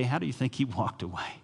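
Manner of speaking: usual speaking voice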